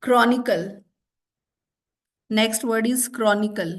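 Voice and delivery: slow, deliberate dictation; spell-test reading pace. Shown as a demonstration.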